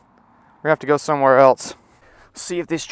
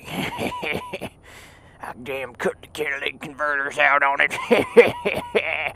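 slow words breaking into giggles; tickled by his own mischief; gleeful laughing; 5 dB quieter, much slower than usual. Real, not acted. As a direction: confess it through wheezy laughter.

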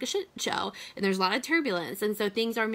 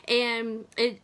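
usual speaking voice

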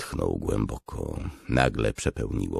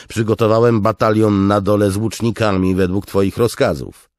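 high, loud voice throughout; urgent report; 9 semitones higher, 10 dB louder than usual. Acted. Officer reports to his commander amid crashing, raised voice. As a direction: report urgently over the noise.

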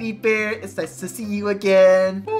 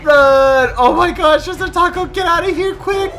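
loud yell climbing into high panic; urgent, high-pitched alarm; panicked yelling; 8 semitones higher, 7 dB louder than usual. Acted. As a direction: flee in a hammed-up fright. Acted flight from fangirl.

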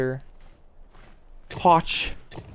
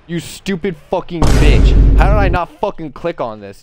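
loud, higher-pitched raging; angry outburst; 5 semitones higher, 11 dB louder than usual. Real, not acted. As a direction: burst out furious at your own blunder. Rages at his own costly mistake.

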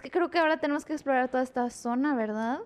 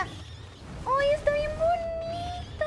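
adoring coo; 12 semitones higher, much slower than usual. Real, not acted. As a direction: coo over something tiny and cute. Cooing over a cute little creature. (vocecita aguda de ternura)

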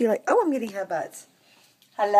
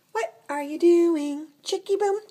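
much higher, slow singsong question; pet-talk coo; 8 semitones higher than usual. Acted. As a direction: stretch it out sweetly to the cat.

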